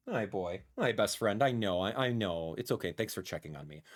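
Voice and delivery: low, soft reassurance; tender pet talk. Real, not acted. Streamer thanking his dog for checking on him, tender. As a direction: murmur affectionately to your dog, pitch dropped.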